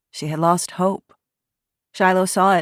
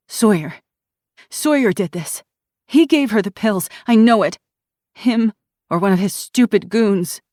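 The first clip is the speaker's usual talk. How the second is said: louder, bitter anger; angry accusation; 5 semitones higher, 6 dB louder than usual. A narrator's reading. Angry girl blaming Sawyer, furious. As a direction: spit out the blame. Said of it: "Anger sparked through her veins".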